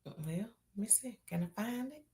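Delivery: quiet whisper to herself. Real, not acted. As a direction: whisper it while searching. Searching for the icebreaker quietly.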